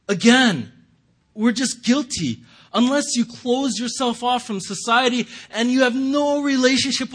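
preacher hammering home that all are guilty; forceful preaching. Acted. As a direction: press the point hard to the congregation.

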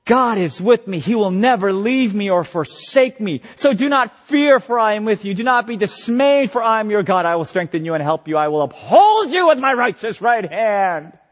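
lifted, earnest scripture promise; reassuring preaching. Retold or imitated. Preacher quoting God's promise to himself, earnest lift. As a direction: speak God's comfort over yourself. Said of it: "I preach the gospel to myself and I say".

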